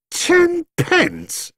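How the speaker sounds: very high, squealed disgust. A narrator's reading. Jonathan scorning the offer of ten pence, disgusted. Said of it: "exclaimed Jonathan disgustedly".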